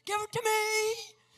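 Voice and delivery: be the whole room begging for a disc; grabby crowd. Retold or imitated. Conference crowd begging the speaker, high eager cries. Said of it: "Everybody's like".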